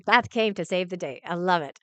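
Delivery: normal talking voice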